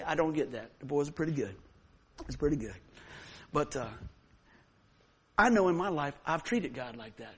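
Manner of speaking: ordinary talk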